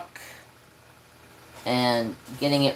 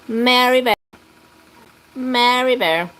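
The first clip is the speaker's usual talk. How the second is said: high cooing pet voice; pet talk to cat; 8 semitones higher, 4 dB louder than usual. Real, not acted. Streamer cooing to his cat, high pet voice.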